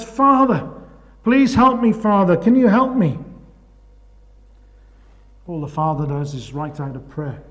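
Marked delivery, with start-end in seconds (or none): 0.0-3.2 higher pleading call to the passing priest; mock plea. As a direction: beg the priest from the hole. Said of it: "he shouts".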